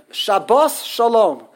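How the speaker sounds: sha-BOS Shalom, slow and loud; stress on last syllable. Shown as a demonstration. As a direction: stretch and punch bos in Shabbos Shalom. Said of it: "The last syllable is bos".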